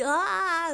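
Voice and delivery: long, high, loud mock "ah"; imitated night cry. Host imitates him at night, high loud mock cry. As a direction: wail a drawn-out "ah" in imitation. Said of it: "s'il était comme ça".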